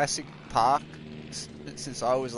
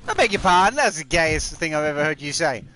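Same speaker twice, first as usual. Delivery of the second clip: taken aback; 2 semitones higher, 6 dB louder than usual. Real, not acted. Player reacting to what was just said, loudly taken aback. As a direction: exclaim it in loud, indignant shock. Startled by child's remark.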